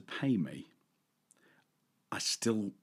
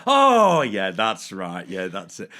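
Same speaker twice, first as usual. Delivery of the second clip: feigned sudden recall; 11 dB louder, slower than usual. Retold or imitated. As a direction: act as if it all comes back. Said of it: "I'm like".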